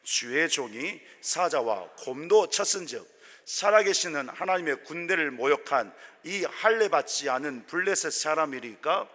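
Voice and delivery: loud, proclaiming scripture read; loud group scripture reading. Shown as a demonstration. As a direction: declare the verse boldly with the congregation. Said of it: "같이 읽어볼게요". (회중과 함께 크게 선포하는 성경 낭독)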